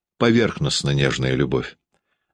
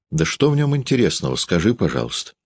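higher, vexed asking; annoyed question; 7 semitones higher than usual. Acted. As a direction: ask with irritation. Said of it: "Дарья спросила досадливо".

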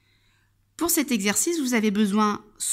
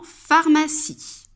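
"pharmacie" slow and louder, clearly set apart; word to search; 6 semitones higher, 5 dB louder, much slower than usual. Shown as a demonstration. Teacher asking where the sound is, "pharmacie" slowly set out. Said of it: "tu essayes de chercher".

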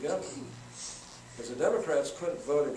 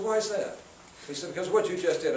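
retold mad exchange; 6 semitones higher, 5 dB louder than usual. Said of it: "that made me mad".

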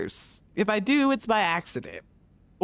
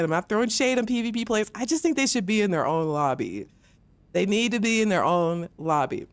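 ordinary talk, nothing marked

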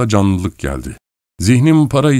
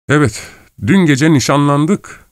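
boastful claim; 8 semitones higher than usual. Acted. Boasting lie to acquaintance.

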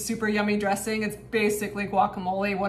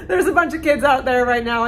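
higher and louder, laughing through it; 5 semitones higher, 6 dB louder than usual. Real, not acted. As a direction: laugh while you repeat it.

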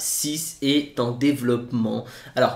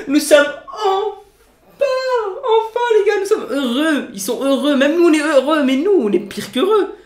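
laughing with joy; 7 dB louder than usual. Real, not acted. Overjoyed at GTA 6 news.

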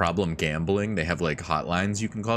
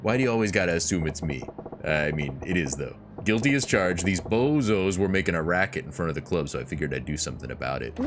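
low, unbothered tough-guy voice; Majima voice; 3 semitones lower than usual. Acted. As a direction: rumble it out like a cocky brawler.

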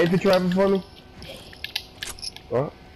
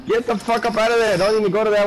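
urgent shout; 7 semitones higher, slower than usual. Real, not acted. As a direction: yell a warning to get out. Yelling urgent warning.

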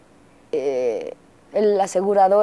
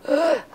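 shocked gasp; 7 semitones higher than usual. Retold or imitated. Seeing son's head cut open.

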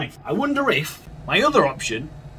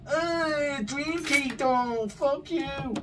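panicked squeak; 11 semitones higher, 3 dB quieter, much slower than usual. Real, not acted. Panicking at ghost.

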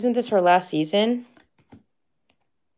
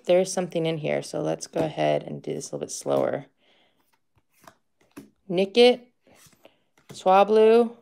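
ordinary talk

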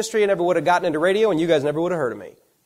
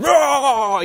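imitated roar; 7 semitones higher than usual. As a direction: roar like an angry teacher yelling at students. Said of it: "and yelled at him".

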